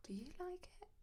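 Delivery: high airy whisper; soft whisper. Shown as a demonstration. Tattooist rehearsing the trick to herself, high whisper. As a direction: whisper it sweetly. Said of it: "I'm gonna start doing that".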